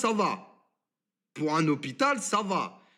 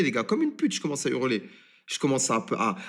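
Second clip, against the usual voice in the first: ordinary talk, nothing marked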